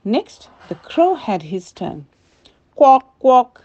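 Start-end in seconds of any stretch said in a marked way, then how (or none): 2.8-3.6 a loud, high quack quack in a bird voice; quacking call. A narrator's reading. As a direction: call it out like a duck. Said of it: "he called out".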